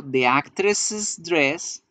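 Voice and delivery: the actress's dress with actress's said as two syllables, slow; correct possessive. Shown as a demonstration. Teacher modelling the correct possessive, actress's spelled out slowly. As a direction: say actress's fully, with the extra -es syllable before dress. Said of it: "como están viendo".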